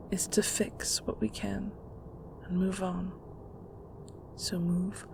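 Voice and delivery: put-on a mother coaxing her heartbroken girl; soft coaxing. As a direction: soothe her gently. Mother soothing her heartbroken daughter.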